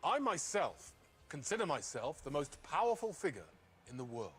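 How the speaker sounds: slow, loud, weighty declamation; grand quotation reading. Acted. Actor reading a quotation on ego, grand declamation. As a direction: proclaim it grandly with drawn-out pauses.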